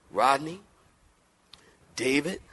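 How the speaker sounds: ordinary talk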